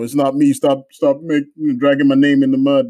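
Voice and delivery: normal talking voice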